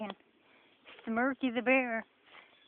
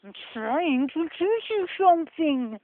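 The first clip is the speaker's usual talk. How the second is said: higher, louder presenter voice, hammed up; mock educational TV host; 4 semitones higher, 4 dB louder than usual. Hiker parodying a TV show host. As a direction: play the earnest nature-show teacher. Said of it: "Educational T V show voice".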